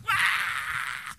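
put-on loud breathy baby cry; mock infant wail. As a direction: imitate a wailing baby. Crying baby imitated.

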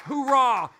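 rally the recruits with one loud cry; hoorah shout. Acted. Pastor pumping up recruits with a loud hoorah. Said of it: "a little hoorah at M C R D with some recruits".

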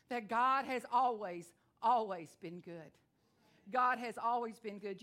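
loud, slow preaching proclamation. Acted. Preacher declaring to the church, loud and slow. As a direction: declare it to the congregation.

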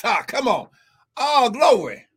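normal talking voice

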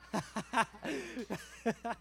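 breathy chuckling at own joke; amused run of laughs; laughter. Real, not acted. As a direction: crack up for a moment.

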